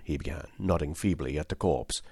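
ordinary delivery, nothing marked

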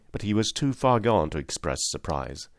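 ordinary delivery

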